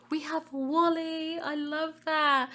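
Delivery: high, slow delighted coo. Real, not acted. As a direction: coo like it's adorable. Delighted at spotting Wally.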